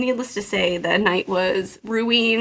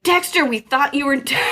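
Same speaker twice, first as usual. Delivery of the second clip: put-on blunt kid shout; 4 semitones higher than usual. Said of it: "he has no filter".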